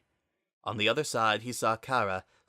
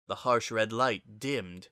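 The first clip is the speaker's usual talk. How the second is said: usual speaking voice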